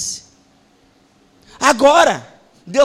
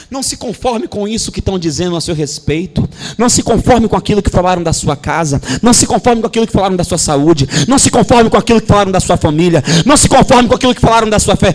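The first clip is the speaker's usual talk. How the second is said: preaching cadence; 4 semitones lower than usual. Real, not acted. Pastor urging the church not to conform, building pace. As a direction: drive the refrain faster each time.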